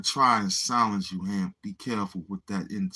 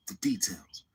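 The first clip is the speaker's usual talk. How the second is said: soft whispered emphasis; secretive whisper; 3 semitones lower, 6 dB quieter than usual. Acted. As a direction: breathe the words out. Hushed focus on details.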